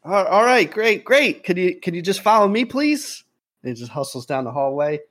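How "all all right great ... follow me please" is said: put-on eager young man. Eager young man hurries pilots.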